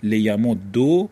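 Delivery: «لیمد دو» ending in doubled d and a drawn-out "o"; final damma vowel. Shown as a demonstration. Teacher matching the middle vowel, «لیمد دو» ending in -ddo. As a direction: say «لیمد دو» slowly as li-ya-mud-DO. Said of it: "به تناسب ضمهٔ عین الفعل".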